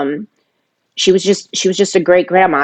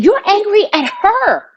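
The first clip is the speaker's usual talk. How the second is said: very high, insistent pitch; high-pitched retold accusation; retold confrontation; 13 semitones higher than usual. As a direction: confront him, pitch way up, volume held. Acted confrontation with her brother.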